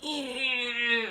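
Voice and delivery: long half-hearted straining "eh"; fake straining sound. Her pretending to push in labor, fake straining. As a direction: imitate pushing with a held strained noise. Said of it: "I'm just making a sound".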